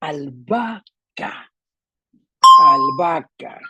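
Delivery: slow "Albahaca", broken up with the middle BA punched; stress on BA. Shown as a demonstration. Teacher demonstrating the tonic syllable, BA stressed in "albahaca". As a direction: stretch and stress the BA sound in "albahaca".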